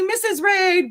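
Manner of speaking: quick high excited call of her name. Real, not acted. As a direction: fling her name out with joy. Greeting a favourite chatter.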